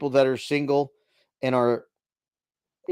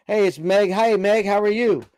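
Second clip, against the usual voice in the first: higher, louder chirpy greeting; imitating Meg; 8 semitones higher, 5 dB louder than usual. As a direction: put on a bright friend's hello. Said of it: "See, Meg doesn't call me host".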